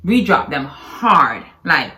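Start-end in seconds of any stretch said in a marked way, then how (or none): 0.6-1.4 HARD with a heavy, drawn-out H. Real, not acted. Wife stressing her point to her husband, hard H. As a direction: lean on the H in HARD. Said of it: "you're hurting the H on that word".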